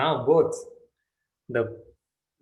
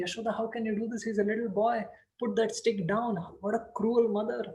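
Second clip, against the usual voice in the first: higher, quick reproach to a cruel mother; reproachful onlookers; 7 semitones higher than usual. Acted. Krishna's supporters scolding Yashoda, quick and reproachful. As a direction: chide her for chasing a little boy.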